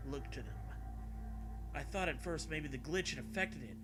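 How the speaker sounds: usual speaking voice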